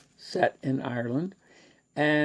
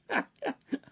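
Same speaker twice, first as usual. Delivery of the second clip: embarrassed laugh; 14 semitones higher, 6 dB quieter than usual. Real, not acted. Embarrassed at his reading.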